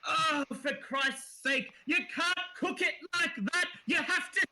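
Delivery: high, exasperated shout from above; exasperated high-pitched shouting; shouting halfling. Acted. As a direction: yell it down at the cooks, fed up. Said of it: "and she's shouting".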